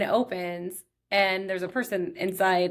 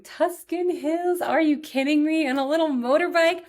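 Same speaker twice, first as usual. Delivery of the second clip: excited gush; 8 semitones higher, 4 dB louder than usual. Real, not acted. Gushing over sidecar ride.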